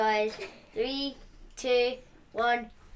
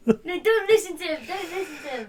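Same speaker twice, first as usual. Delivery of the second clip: laughing protest; 6 semitones higher, 5 dB louder, faster than usual. Real, not acted. Boy protests laughing at dad.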